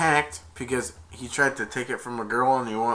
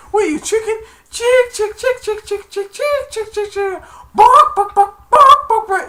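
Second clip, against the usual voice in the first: squeaky high clucking mockery; chicken taunt; 12 dB louder than usual. Santa daring the man to fight, taunting chicken squawks. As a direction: cluck rapidly, then louder squawks.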